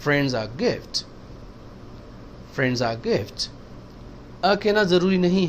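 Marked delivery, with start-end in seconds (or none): 0.0-3.5 friends and gift stressed, are swallowed. Shown as a demonstration. Teacher demonstrating stress in the example sentence. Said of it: "friends और gift पे stress आएगा".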